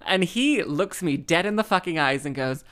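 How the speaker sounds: normal talking voice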